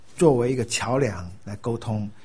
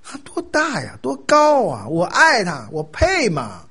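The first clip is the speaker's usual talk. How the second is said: high, swooping, incredulous protest; awed exclamation; 5 semitones higher, 4 dB louder, slower than usual. Acted. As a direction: exclaim in awe, stretching the key words high. Acting out an awed believer's reply.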